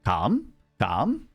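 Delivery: ordinary talk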